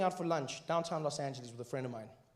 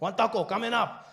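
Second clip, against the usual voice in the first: high, loud order call; taco truck vendor; 7 semitones higher, 6 dB louder than usual. Retold or imitated. Taco seller confirming an order, loud vendor call. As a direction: shout the order back. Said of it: "He's like".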